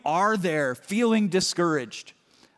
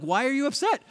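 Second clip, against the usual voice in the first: puzzled question; 8 semitones higher, faster than usual. Retold or imitated. Retelling a dishes quarrel with wife.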